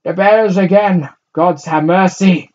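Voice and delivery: put-on high, slow, loud old woman's wail. A narrator's reading. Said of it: "wailed an old woman".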